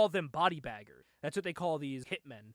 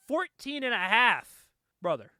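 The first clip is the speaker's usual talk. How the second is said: sassy retort; 6 semitones higher, 9 dB louder than usual. Retold or imitated. Teen girl retort, quoted.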